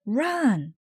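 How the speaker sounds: high, urgent run; shouted warning. A narrator's reading. Lou warning teammates of the cat, high shout. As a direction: warn everyone to flee. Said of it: "shouted Lou".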